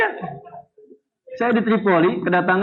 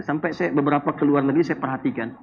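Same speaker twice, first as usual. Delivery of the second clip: normal talking voice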